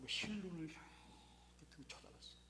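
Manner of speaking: slow hushed whisper. Acted. Preacher whispering to the congregation to build suspense. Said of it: "whispered narration".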